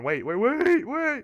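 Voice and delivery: rising, high stretched yelp; panicked. Real, not acted. Streamer caught off guard by the restart, panicked. Restart begins before he is ready.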